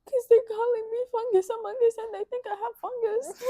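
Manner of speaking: high, whiny retold complaint; mock tearful schoolgirl; imitating upset girl. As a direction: whine it up high like a hurt kid. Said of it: "And she's like".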